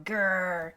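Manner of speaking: low playful mock growl; dog growling at the mayor in anger. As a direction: growl like an angry little dog. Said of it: "Mary's dog below going".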